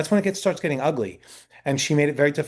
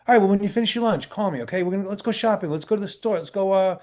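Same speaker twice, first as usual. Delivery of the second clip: imitating an excited friend, higher-pitched; eager planning; 7 semitones higher than usual. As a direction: rattle off plans in a higher voice. Actor eagerly plans shopping outing.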